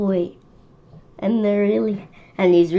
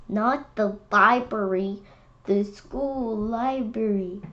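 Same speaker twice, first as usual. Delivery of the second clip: normal talking voice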